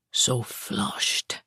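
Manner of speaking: soft whispered Italian tease; teasing whisper. A narrator's reading. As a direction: whisper it knowingly. Teasing whisper, measured unvoiced.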